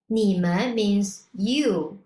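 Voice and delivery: usual speaking voice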